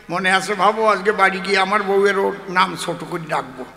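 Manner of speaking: ordinary talk, nothing marked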